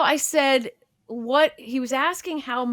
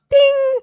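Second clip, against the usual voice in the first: high, loud sung "ding" like a bell; ping it out like a chime; playful chime sound; 12 dB louder than usual. Pretend. Imitating a bell for each extraction.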